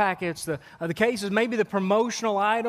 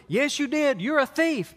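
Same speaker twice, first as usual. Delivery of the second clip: higher, firmly scolding mother; mom scolding; 8 semitones higher than usual. Retold or imitated. Mother to her caught child, firmly scolding. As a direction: lightly play the stern mom. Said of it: "And she".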